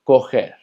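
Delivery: coger said "ko-HEHR", the rr as a throaty h; Portuguese correr. Shown as a demonstration. Teacher modelling Portuguese correr, throaty h. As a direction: coger with a breathy h in the middle. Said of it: "se pronuncia coger".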